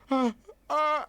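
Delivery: high, loud sobbed "ah, ah"; mock bawling. Storyteller sits down and starts bawling, mock bawling. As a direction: act out bursting into tears. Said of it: "I just started bawling my eyes out".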